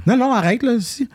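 high-pitched imitated protest; mocking schoolmates. Imitating people tired of how he talks, high mocking voice. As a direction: mimic a teaser telling someone to quit it. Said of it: "j'étais tanné que le monde disait".

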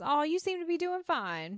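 high, cutesy imitated reassurance. Carlene reassures a crying man, cute and high. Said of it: "Carlene is very cute and says".

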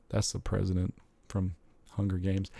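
normal talking voice

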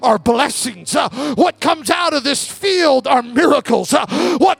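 slow, shouted revival sermon; full-force preaching. Acted. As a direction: preach it to the whole church.

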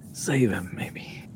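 a quick whisper; whispered aside. Acted. Cleric adding a sheepish whispered hedge to the prayer.

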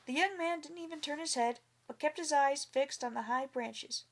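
usual speaking voice

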